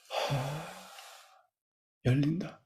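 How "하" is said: slow audible breath out through the mouth; long exhale. Shown as a demonstration. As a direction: let the breath go, soft and long. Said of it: "숨을 내쉬면서". (길게 내쉬는 숨소리)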